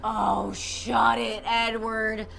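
low, slow dismissive drawl; a touch of mock contempt; slow put-on scornful voice. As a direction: brush him off slowly. Kelly scolds in put-on voice.

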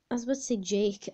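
usual speaking voice

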